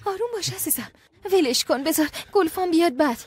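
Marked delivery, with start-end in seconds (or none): 0.0-0.9 soothing. Acted. Wife soothes her angry husband.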